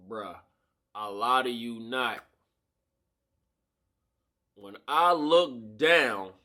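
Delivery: ordinary delivery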